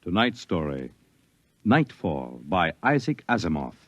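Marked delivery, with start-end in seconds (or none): none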